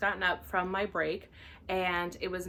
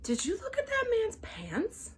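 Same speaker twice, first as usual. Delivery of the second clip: high, slow gossipy whisper-question; gossipy coworker; 12 semitones higher, slower than usual. Retold or imitated. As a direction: lean in and dish the gossip. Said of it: "she goes".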